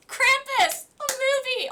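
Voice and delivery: high excited burst of recognition; sudden recall. Real, not acted. To the chat, shouting the title she just remembered. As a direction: blurt it out the moment it clicks.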